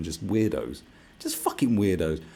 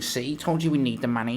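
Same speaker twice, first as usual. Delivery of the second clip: smug mock voice; 7 semitones higher, 4 dB louder than usual. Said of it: "it's like".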